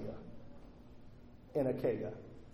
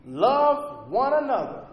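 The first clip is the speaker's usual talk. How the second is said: ordinary delivery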